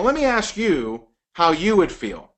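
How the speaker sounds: ordinary delivery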